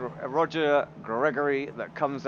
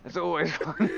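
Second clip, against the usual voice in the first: ordinary talk, nothing marked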